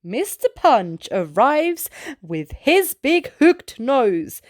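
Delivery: usual speaking voice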